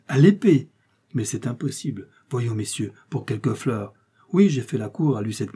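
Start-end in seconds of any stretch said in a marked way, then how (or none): 0.1-0.7 "À l'épée!" shouted louder and higher; louder alarmed cry. Acted. The factory owner hears the weapon is the sword, panicked louder cry. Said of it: "s'exclama le malheureux usinier".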